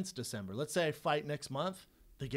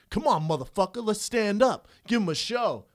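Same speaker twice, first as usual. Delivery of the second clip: higher, louder goading call; taunting opponent; 8 semitones higher, 9 dB louder than usual. Retold or imitated. Opponent on the ground goading him to stand, loud taunt. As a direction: egg him on to stand up and brawl.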